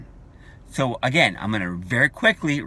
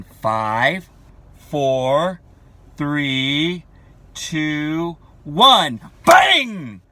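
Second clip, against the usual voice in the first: countdown to bang; 2 semitones higher, 8 dB louder, much slower than usual. Acted. Counting down to a sudden change.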